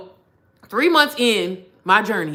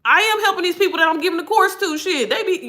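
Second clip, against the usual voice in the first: high, pitched-up protest to God; indignant protest; 9 semitones higher than usual. Retold or imitated. Retelling her protest to God, high and indignant. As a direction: push back at God, exasperated. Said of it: "And I'm like".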